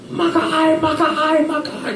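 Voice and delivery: high, imitated yelling gambler; mock angry old gambler. Comic imitating an old gambler yelling at the dealer, high. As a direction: squawk it high like the old guy. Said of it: "Japanese guy just".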